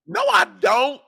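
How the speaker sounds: very high, squeaky denial; mocking squeaky voice. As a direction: squeak it like a whiny kid. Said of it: "You're like".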